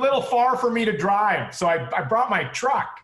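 high, nerdy suburban guy; high dentist voice. Retold or imitated. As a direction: chirp it like a dorky dad. Voicing a dentist biker.

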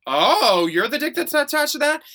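high, loud shocked retort; retelling meeting the doctor, high loud surprised; mock surprise. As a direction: shriek it in mock disbelief. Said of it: "And I was like".

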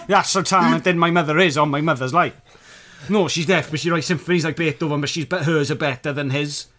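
loud, fast, laughing boast; school liar's tall tale. Acted. Liar boasting about his mother to classmates, loud. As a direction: pile on the lies, breathless and loud.

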